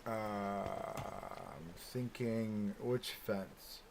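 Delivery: talking through a real yawn, slow. Real, not acted. Streamer mulling over fences while yawning. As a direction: stretch the words out mid-yawn. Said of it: "Excuse me, yawning".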